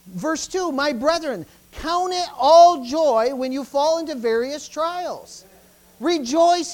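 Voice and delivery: loud, slow, driving sermon delivery; full-force preaching. Acted. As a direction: preach it hard to the congregation. Preaching on the James verses.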